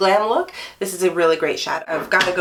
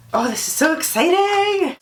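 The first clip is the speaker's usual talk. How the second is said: excited; 10 semitones higher than usual. Real, not acted. Opening new eyeshadow.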